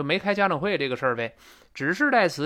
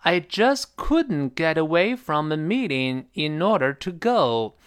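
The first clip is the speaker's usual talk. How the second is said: slow, emphatic "just couldn't", falling "go"; key words stressed; slower than usual. Shown as a demonstration. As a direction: stretch and lift the key words, fall on "go". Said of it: "红色单词要重读".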